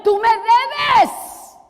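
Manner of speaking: loud, high cry of you owe me; heart crying out. Acted. Preacher voicing the heart's cry, loud and slow. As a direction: shout it as the wounded heart demanding payment. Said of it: "Nuestro corazón grita".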